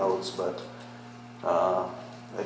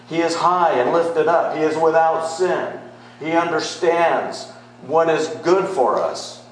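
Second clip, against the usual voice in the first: loud, high, driving every line home; full-force preaching; 7 semitones higher, 6 dB louder than usual. Acted. Pastor urging beloved to grasp God's holiness, loud preaching. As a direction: preach it hard to the congregation.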